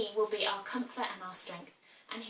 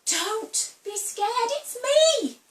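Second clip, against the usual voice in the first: reassuring Jesus; 12 semitones higher, 5 dB louder, much slower than usual. Acted. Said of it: "And Jesus says".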